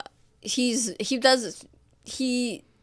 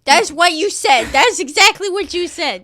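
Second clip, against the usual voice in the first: loud exasperated; 7 semitones higher, 9 dB louder than usual. Acted. Exasperated creature retort.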